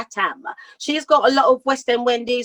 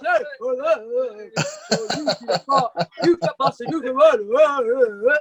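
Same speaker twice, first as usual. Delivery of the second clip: rough London voice; slower than usual. Retold or imitated. Singer imitates her rough rock bandmates, mock London drawl. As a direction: ham up the band's rough talk. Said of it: "they all talk like that".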